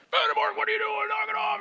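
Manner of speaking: coach yelling. Retold or imitated. Coach yells at me in practice.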